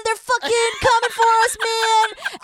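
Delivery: frantic mock warning to everyone; panicked warning. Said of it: "And the dude's like".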